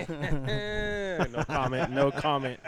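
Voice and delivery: laughing evasion. Real, not acted. Driver dodging how close he gets to the wall, laughing. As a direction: laugh off the question. Dodging a teasing question about driving.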